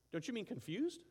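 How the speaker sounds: ordinary delivery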